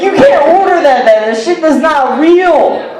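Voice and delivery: high-pitched, fast incredulous objection; exasperated protest. Acted. Wendy's worker objecting to a customer's order, exasperated. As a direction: protest in mock outrage, voice jumping up.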